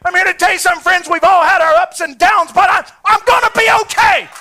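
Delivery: builds to a loud, high preaching shout. Real, not acted. Preacher to congregation, rising into a loud shout. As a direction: lift it up and shout the victory.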